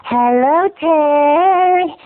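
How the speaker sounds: high, drawling Southern greeting; Southern lady. Acted. Southern neighbor hails boy, Southern drawl. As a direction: call out with sugary Southern hospitality. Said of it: "Southern hospitality".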